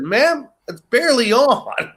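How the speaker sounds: defensive politeness. Retold or imitated. Guest answering old lady at the door, defensively polite.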